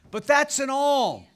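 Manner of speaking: high, loud slow declaration; preacher driving the point home; emphatic. Real, not acted.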